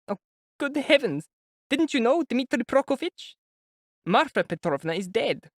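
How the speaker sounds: high, anxious mother's voice; an older woman fretting before meeting her son; high, worried mother voice; fretful mother. A narrator's reading. Pulcheria exclaims.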